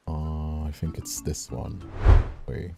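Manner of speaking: ordinary delivery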